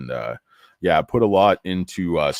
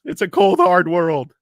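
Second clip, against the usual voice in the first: melodramatic cry; 11 semitones higher, 7 dB louder than usual. Pretend. Dramatic pronouncement, much higher, louder.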